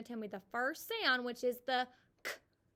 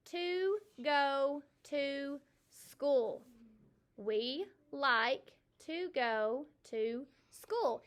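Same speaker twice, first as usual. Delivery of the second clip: slow read-aloud; 2 semitones higher, much slower than usual. Shown as a demonstration. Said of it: "here it is in a sentence".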